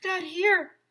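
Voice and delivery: high, loud yell of warning. Acted. Kids yelling as the teacher drops in. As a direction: shout it out in alarm. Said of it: "we were yelling".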